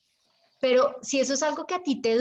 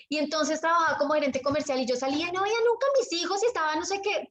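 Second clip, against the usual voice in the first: fast, higher and hurried; rushed telling; 4 semitones higher, faster than usual. Shown as a demonstration. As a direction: rush through the story carelessly. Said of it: "otra vez volvamos a lo mismo". (rápido y atropellado, sin pausas)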